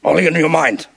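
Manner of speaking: lightly voiced wise alien master; Yoda. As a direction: lightly play the patient little master, pausing between phrases. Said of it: "and Yoda says".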